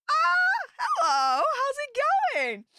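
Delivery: high, loud mock-excited hello; excited greeting. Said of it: "And I'm like".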